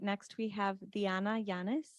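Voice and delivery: normal talking voice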